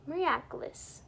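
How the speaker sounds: usual speaking voice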